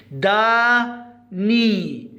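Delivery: داني very slow, a gap between دا and ني; split syllables. Shown as a demonstration. Teacher isolating the syllable ني, داني very slowly split. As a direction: break داني into two drawn-out syllables. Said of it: "لاحظوا الملون باللون الأحمر عندنا ني".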